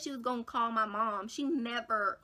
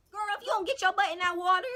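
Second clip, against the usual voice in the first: fast, high, loud imitation of mom; scolding mom; 9 semitones higher, 6 dB louder, faster than usual. Mom ordering her into the bath, loud scolding. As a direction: rattle off a mock motherly order. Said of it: "my mom ran in there, and you know her".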